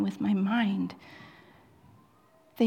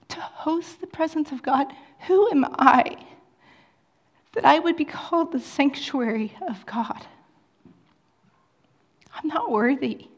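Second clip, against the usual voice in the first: impassioned preaching; 4 semitones higher than usual. Acted. Emotional preaching, higher pitch.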